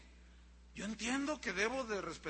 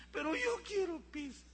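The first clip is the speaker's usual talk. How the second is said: put-on high-pitched child's protest; 8 semitones higher than usual. Child answers parent's talk of vegetables, high protesting voice. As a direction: insist like a child who wants pizza. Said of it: "Y te va a decir".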